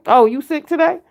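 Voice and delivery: high, loud, knowing tone; mock doubtful mother. Mother doubting her child is sick, high and sarcastic. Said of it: "mother checking fake sick child".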